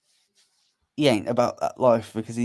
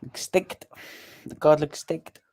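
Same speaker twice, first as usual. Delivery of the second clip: put-on playful South African accent, pitched higher; 4 semitones higher, slower than usual. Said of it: "I tried my South African accent".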